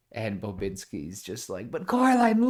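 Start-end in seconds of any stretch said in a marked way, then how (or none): 1.7-2.5 high eager plea; excited high voice; eager Bobinsky. Acted. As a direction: plead excitedly.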